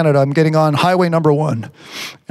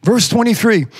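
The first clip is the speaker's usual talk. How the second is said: normal talking voice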